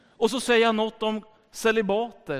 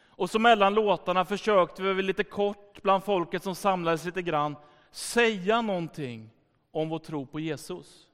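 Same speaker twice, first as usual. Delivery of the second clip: ordinary delivery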